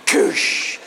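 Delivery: unvoiced crashing "koosh"; imitated metal clang. Storyteller imitating the metal door swinging open, mouthed clang. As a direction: burst of hissed door-crash noise. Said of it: "swinging open with a clang".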